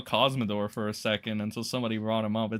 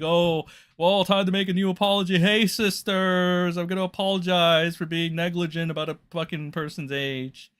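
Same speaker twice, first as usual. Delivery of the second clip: mocking James Charles; 6 semitones higher, 3 dB louder than usual. Streamer mocking a YouTuber's apology, higher mock voice. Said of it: "and then he'll be like".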